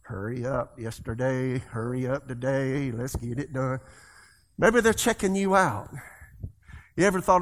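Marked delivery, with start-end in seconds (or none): 0.0-3.8 low, hushed grumble of impatience; quiet, low aside of a hurried shopper; impatient muttering. Acted. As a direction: mutter it under your breath, mock-impatient. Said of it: "you're kinda like".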